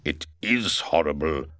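a hint of low, grim invisible man; a lightly voiced Griffin. A narrator's reading. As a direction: agree darkly in Griffin's voice. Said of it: "said Griffin".

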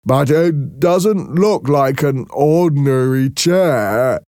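high, slow, gentle-mannered Merrick voice; timid deformed man, high and slow; Merrick's voice. A narrator's reading.

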